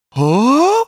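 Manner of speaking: baffled huh. Retold or imitated. Imitating wide-eyed sheltered girl.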